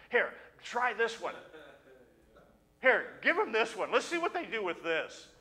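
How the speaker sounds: mischievous angels egging each other on; preacher voicing angels tossing temptations; playful angels. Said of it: "they're saying".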